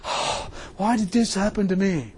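quiet, slow mock whine; imitating people feeling sorry for themselves; softly mocking a moaner; mock self-pity. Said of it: "people sit around".

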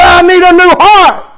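high, loud imitated scream; retold scream, high and loud. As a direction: scream it like the caller did. Woman on phone demands healing.

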